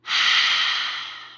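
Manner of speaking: long audible exhaled sigh; deep sigh. Shown as a demonstration. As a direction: breathe out fully in one deep sigh. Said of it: "exhale it out with a deep sigh".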